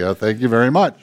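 lightly acted sarcastic thanks; mildly deflated thanks. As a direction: mutter mock gratitude to a heckler. Pastor's deflated reply to heckler.

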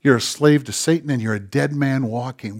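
hushed voiced murmur, as God speaking; softly voiced imitation of a whisper; mild stage whisper. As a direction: speak it softly, almost confiding. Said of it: "God whispered".

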